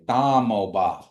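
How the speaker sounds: Damo ba, met de T-achtige D; Kiowa demo. Shown as a demonstration. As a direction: zeg "Da mo ba" duidelijk, lettergreep voor lettergreep. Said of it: "So you say".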